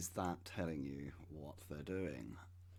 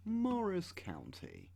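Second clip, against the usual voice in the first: much higher, slow Morris County; mock grand naming; 12 semitones higher, slower than usual. Shown as a demonstration. Repeating the county name to himself, now high and slow.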